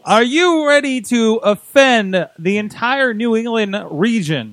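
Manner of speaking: slow, drawn-out hype announcer; big mock-announcer build-up; show announcer. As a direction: pitch up and stretch it like a ring announcer. Host hypes an accent stunt as announcer.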